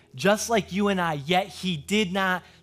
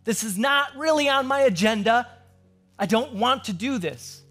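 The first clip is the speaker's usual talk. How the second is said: high, loud plea to a father; agonized pleading; 7 semitones higher, 4 dB louder than usual. Acted. Jesus praying to his father in the garden, agonized raised-voice pleading. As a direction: cry out in protest, acting out the agony. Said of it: "we see him agonizing with his father".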